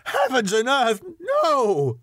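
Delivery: high, crying outburst; passionate cry. A narrator's reading. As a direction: burst out in anguished refusal. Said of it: "cried Arthur in a storm of passion".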